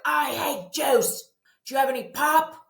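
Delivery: put-on high, slow, loud child voice refusing juice; whiny kid. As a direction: play a kid turning up their nose at juice. Child refusing juice, asking pop.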